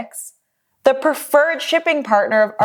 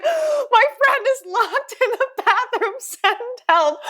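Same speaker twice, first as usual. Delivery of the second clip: much higher, hammed-up frantic call for help; mock panicked plea; 12 semitones higher than usual. Calling the front desk in mock panic about her locked-in friend. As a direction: sound frantic on the phone to reception.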